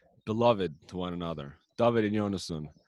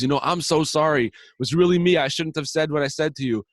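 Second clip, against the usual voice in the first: higher, louder, urgent apology; earnest apology; 8 semitones higher, 6 dB louder than usual. Retold or imitated. Second friend apologising at the same moment, urgent. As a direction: rush to take the blame, pitched up and loud.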